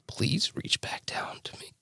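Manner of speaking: slow, high whisper of pleading. Retold or imitated. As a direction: reach out to God in a hushed plea. Crying while praying.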